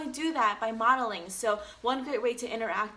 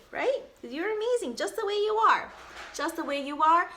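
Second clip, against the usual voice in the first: excited praise; 6 semitones higher, 4 dB louder than usual. Real, not acted. Enthusiastic praise.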